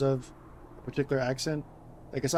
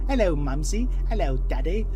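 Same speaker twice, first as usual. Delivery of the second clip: put-on louder, plummy posh English accent; posh Queen's English; 4 semitones higher, 7 dB louder than usual. A posh child greeting Mummy and Daddy, in a posh English accent. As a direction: greet them like upper-class English gentry. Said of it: "like the Queen's English".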